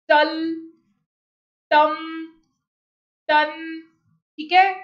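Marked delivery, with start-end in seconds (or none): 0.1-3.5 टल, टम, टन as separate short syllables, each ending held on its consonant; syllabic consonant demo. Shown as a demonstration. Teacher showing students syllabic consonants, टल, टम, टन isolated with held final consonants. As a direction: sound out टल, टम, टन one by one, with pauses. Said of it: "ये जो sounds होते हैं".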